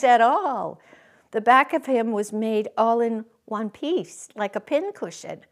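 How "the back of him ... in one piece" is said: ordinary delivery, nothing marked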